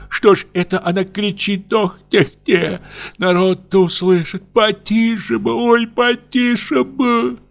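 frantic hush, slowing on the last plea; panicked old woman. A narrator's reading. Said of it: "бормотала она в ужасе". (гораздо выше, в ужасе, умоляя тише)

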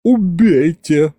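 high, drawn-out melodrama; pathetic cry. A narrator's reading. Butler begging the tsar to kill him, pathetic cry. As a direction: offer yourself up theatrically. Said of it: "патетически вскрикнул Гюнтер".

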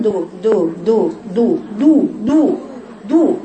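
repeated دو with fully rounded lips; damma demo. Shown as a demonstration. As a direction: drill دو one syllable at a time. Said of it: "ما تعرفش يجيبوا الضمة".

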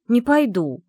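stubborn refusal. Acted. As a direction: dig in your heels. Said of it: "нервно передёрнула я плечами". (громкий упрямый отказ)

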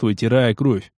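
usual speaking voice

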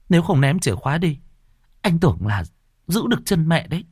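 high, animated sister's voice; older sister, insistent explaining. Acted. Sister explains mother's strange nights to brother, insistent.

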